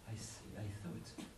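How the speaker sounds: ordinary delivery, nothing marked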